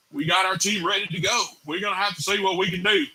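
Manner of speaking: put-on Southern hillbilly accent coach; Kirby Smart hillbilly accent. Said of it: "going hillbilly on this and impersonating him".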